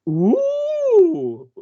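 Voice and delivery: high, loud impressed ooh; a Peppa Pig style posh ooh of delight; squealed high ooh of being impressed; British impressed ooh. Retold or imitated. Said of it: "they go".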